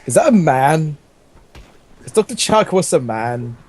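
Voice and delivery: ordinary talk, nothing marked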